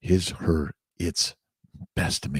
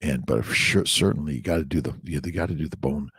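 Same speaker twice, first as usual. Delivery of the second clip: ordinary talk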